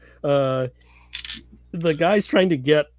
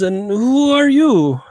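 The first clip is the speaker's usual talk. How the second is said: put-on slow, higher, louder drunk question; drunk Russian agent; 9 semitones higher, 5 dB louder, slower than usual. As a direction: lean in and demand it, tipsy.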